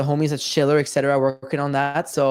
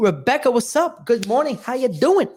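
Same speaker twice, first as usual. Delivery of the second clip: high-pitched, upbeat welcome; excited greeting; 7 semitones higher than usual. Real, not acted. Host welcomes partner Rebecca onto the show, excited greeting. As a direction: greet a guest with rising, high energy.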